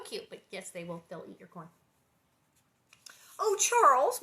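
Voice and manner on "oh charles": high, loud, amused protest; mock-scold a husband, amused; playful protest at a bad pun; laughing protest. A narrator's reading. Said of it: "Ma protested, laughing".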